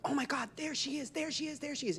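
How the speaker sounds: high squealing crowd chant; high-pitched excited fan squeal; excited fans calling. Retold or imitated. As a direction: gush like starstruck fans spotting her. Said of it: "everybody's like".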